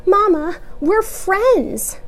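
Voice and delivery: put-on high child voice; pleading child. A narrator's reading. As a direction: urge your mother. Child begging.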